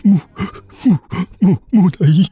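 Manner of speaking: high, panicked stuttering; terrified stammer. Acted. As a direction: stammer as if frozen with fear. Stammering at a living mummy.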